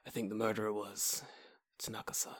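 soft, hushed detective with a long pause; mock film-noir accusation; dramatic movie line. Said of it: "movie with a dramatic pause".

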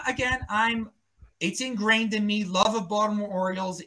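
ordinary talk

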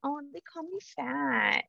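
high, soft, slow mock child voice; small, drawn-out kid voice; hurt little kid. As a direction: imitate a child's wounded thought. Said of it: "My kids have never thought".

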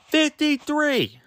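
high, slow, stunned repeat; can't believe the number; disbelief. Real, not acted. Podcaster to listeners about the grade, stunned and high.

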